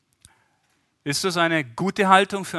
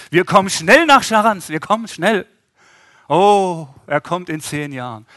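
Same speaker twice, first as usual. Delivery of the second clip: mock hollow promise; 4 semitones higher, 10 dB louder than usual. Mocking empty promise.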